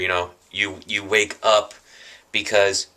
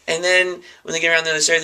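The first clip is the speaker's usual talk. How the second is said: ordinary talk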